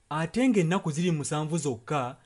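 ordinary delivery

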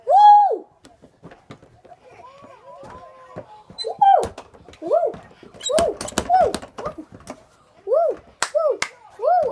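loud high joyful whooping; excited whoops. Real, not acted. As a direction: whoop with real glee. Celebrating thirty subscribers.

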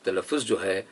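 ordinary talk

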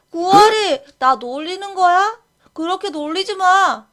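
angry protest. Acted. Boy angry at being teased.

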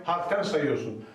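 usual speaking voice

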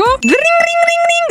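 high, slow sing-song ring ring; mock phone ringing, drawn out.

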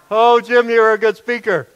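loud, high imitated Indian accent; distinct Indian accent. Friend praises the preacher, loudly imitated Indian accent. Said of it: "He had a distinct accent".

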